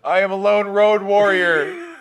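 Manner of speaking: slow, loud, hammed-up hero line through laughter; mock lone road warrior. Player mocking their own answer, slow hammed-up hero voice. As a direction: announce yourself like a cheesy action hero. Imagined public encounter answer.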